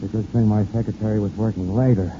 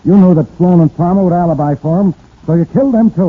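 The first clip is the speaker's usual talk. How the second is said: raised, pointed accusation, higher and louder; accusing; 7 semitones higher, 7 dB louder than usual. Acted. Detective confronting the suspect with rising force. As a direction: nail him with the charge.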